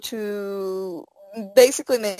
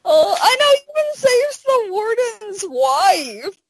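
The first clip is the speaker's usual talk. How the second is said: tearful; 11 semitones higher, 4 dB louder, slower than usual. Real, not acted. Speaker moved to tears.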